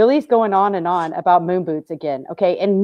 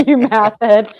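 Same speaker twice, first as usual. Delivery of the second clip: laughing; 3 semitones higher, 5 dB louder, slower than usual. Real, not acted. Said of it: "it would come out math head".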